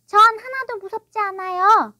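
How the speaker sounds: put-on high, loud little-boy voice; defiant child. A narrator's reading. Small son denying fear to his father, defiant. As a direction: protest bravely as a small boy.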